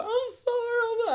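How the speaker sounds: high, strained, tearful repeated apology; retold tearful apology. Apologizing to her friend's mom at the door, mildly tearful. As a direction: plead through the start of tears. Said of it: "I started crying".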